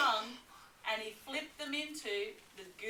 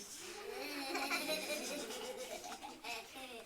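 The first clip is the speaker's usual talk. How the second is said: soft gleeful cackle; 3 semitones higher, 7 dB quieter than usual. Acted. Dingo's greedy laugh.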